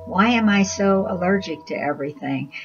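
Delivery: usual speaking voice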